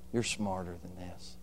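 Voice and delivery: soft, low, calm rebuke; calm mother. Retold or imitated. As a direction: correct him gently, no anger. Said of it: "She didn't get mad".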